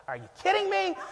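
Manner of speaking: mock disbelief. Paddles left in truck.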